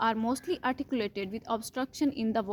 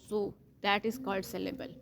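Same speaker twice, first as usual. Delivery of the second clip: ordinary talk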